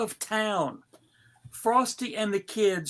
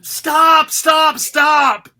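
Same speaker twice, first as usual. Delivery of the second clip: high, loud shouted commands; furious traffic cop yell; cop shouting stop; 6 semitones higher, 10 dB louder, much slower than usual. Acted. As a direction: yell at the runaway parade. Traffic cop halts the parade.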